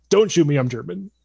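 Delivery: mock surrender shout. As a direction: call it out urgently, imitating a surrendering soldier. Retold surrender plea repeated.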